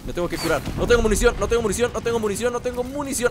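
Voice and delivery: ordinary delivery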